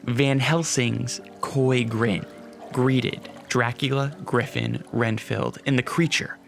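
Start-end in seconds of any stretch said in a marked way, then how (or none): none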